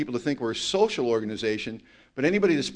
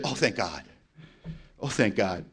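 relieved sister; 5 semitones lower, 5 dB quieter than usual. Retold or imitated. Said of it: "And she said".

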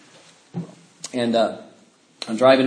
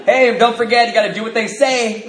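high, louder, laughing mock lecture; mock preachy teasing; 7 semitones higher, 5 dB louder than usual. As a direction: tease like a smug know-it-all. Teasing friends about making amends.

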